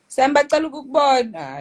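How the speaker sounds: loud, high call to the next room. Real, not acted. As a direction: call her name across the house. Calling out to sleeping sister.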